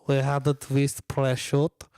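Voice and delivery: put-on slow, jokey Russian accent; mock Russian accent on prosciutto. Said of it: "Is that a Russian accent?".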